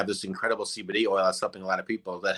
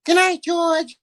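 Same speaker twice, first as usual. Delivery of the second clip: much higher falsetto Gracie; Gracie Allen impression; 5 dB louder, much slower than usual. Answering George as Gracie, high falsetto. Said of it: "say goodnight, Gracie".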